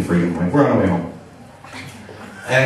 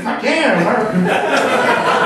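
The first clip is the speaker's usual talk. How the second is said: higher, hammed-up whoop, drawn out; mock patriotic cheer; 7 semitones higher, much slower than usual. Flag cheering a war win, hammed-up patriotic whoop. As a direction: cheer it like a proud rock fan.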